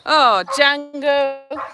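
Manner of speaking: slow, high fond sigh at the dog; drawn-out fond scolding. Real, not acted.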